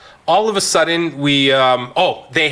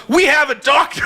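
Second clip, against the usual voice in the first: mock announcement; 12 semitones higher than usual. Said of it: "Brian turns and goes".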